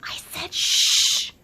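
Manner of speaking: slow, forceful hissed shushing; insistent hiss. Acted. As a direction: hiss it harder with a long shush. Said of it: "Swift hissed again".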